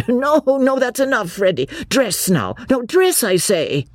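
high, exasperated pleading; flustered protest. A narrator's reading. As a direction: scold high, trying to restore order. Beebe scolds the romping young men.

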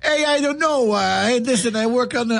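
put-on hammy high Italian accent. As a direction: lay on a silly Italian accent. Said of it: "I drop into my Italian accent".

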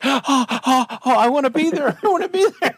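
high, gasping, eager whine; excited longing. Retold or imitated. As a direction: beg to be in the blind.

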